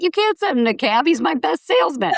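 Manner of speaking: high-pitched mock objection; lightly acted indignant parent; mock protesting parent.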